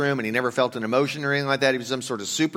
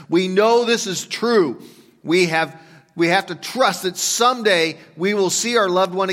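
preaching hope; 7 semitones higher, 6 dB louder than usual. Acted. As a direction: lift the congregation up with hope. Preaching hope at full force.

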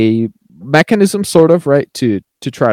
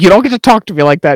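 loud, high, quick mock telling-off; imitated indignant outburst, fast and raised; retold aggression; 8 semitones higher, 8 dB louder, faster than usual. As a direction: act out an outwardly aggressive comeback. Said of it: "very outwardly, like, aggressive to Philip".

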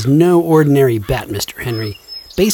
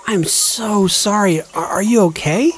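concerned apology; 6 semitones higher than usual. Acted. Apology after hitting friend.

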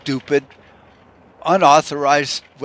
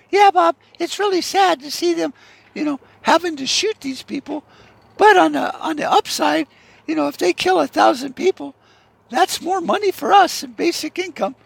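much higher, falsetto parody of a cheerful co-anchor; high mock newswoman; 3 dB louder than usual. Co-anchor Margaret to Bob about the shootings, chirpy falsetto newswoman. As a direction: chirp it like a TV anchorwoman who sees an upside.

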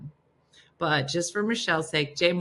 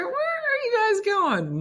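amazed; slower than usual. Real, not acted. Amazed at church cameras.

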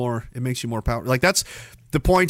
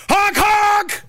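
high loud honking horn; train honk; 14 semitones higher, 3 dB louder than usual. Pretend.

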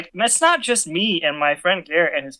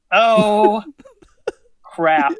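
drawn-out, louder oh, a pause, then crap; dawning dismay; 4 semitones higher, 5 dB louder than usual. Retold or imitated. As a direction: let the disaster sink in slowly. Sudden dismay at overflowing toilet.

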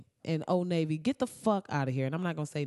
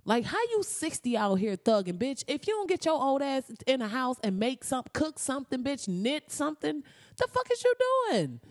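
much higher, rising exasperated scolding; scolding grandma; 9 semitones higher than usual. Pretend. Grandkid scolding sixty-year-old grandma to go home, high exasperated. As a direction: chew her out like a fed-up grandkid.

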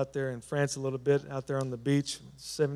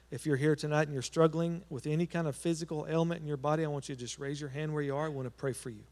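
ordinary delivery

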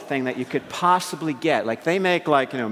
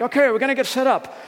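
high, loud, quick organizing call; rally the group like a keen leader; acted brisk leader voice; youth leader; 10 semitones higher, 5 dB louder, faster than usual. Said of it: "the leadership".

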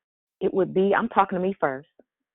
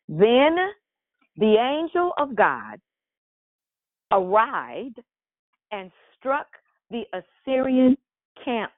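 slow, deliberate reading of scripture with weight on each phrase; 5 semitones higher, slower than usual. Acted. Pastor reads the Bible passage to the church, slow and solemn. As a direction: read the verses out to the congregation, drawing them out.

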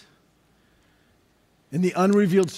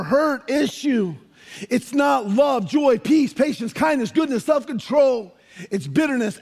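preaching at full force; 5 semitones higher than usual. Acted. Pastor driving home unresolved anger to the congregation, full preaching force. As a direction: preach it out to the church, rising.

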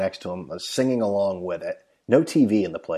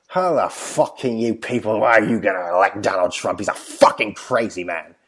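put-on mild Scottish-accented rant at the American; Scottish hooligan; 5 dB louder than usual. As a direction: lightly do the Scottish drunk in a bar. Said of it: "Scottish hooligan".

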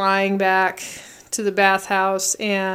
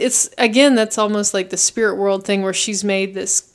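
normal talking voice

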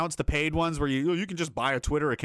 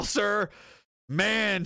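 ordinary talk

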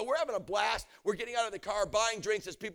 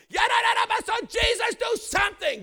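very high, full-force desperate shout; screaming prayer; 10 dB louder than usual. Retold or imitated. Stranded minister praying at locked gates, screaming. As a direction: scream out a plea to heaven. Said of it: "as I'm screaming and shaking the gates".

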